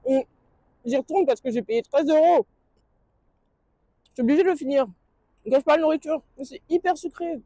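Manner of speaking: talking while chewing; speaking through a mouthful of waffle; mouth full. Real, not acted. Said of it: "désolée de parler à bouche pleine".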